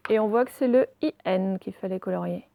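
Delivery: ordinary talk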